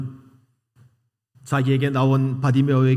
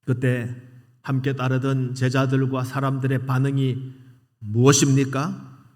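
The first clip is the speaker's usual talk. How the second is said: ordinary talk, nothing marked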